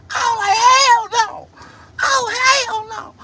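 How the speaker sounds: very high, loud squeaky storytelling; high squealing retelling. Retold or imitated. Telling about the girl's toes, high squealing voice. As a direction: squeal through the whole story, rising to a shriek.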